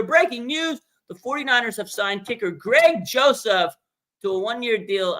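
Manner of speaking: high mock news bulletin; breaking news. Fan imagining the team signing a new kicker, mock news bulletin. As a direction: read it as a playful news flash.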